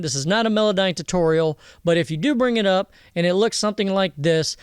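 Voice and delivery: ordinary talk, nothing marked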